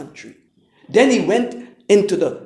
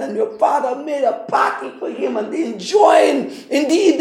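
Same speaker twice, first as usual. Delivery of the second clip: laughing servant; 6 semitones higher, 3 dB louder than usual. Acted. Servant voiced, laughing, joyful.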